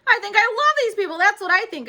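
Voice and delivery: high, loud happy shout; joyful holler. A narrator's reading. Junie hollering her joy to classmates, high and loud. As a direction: burst out with love. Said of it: "I hollered".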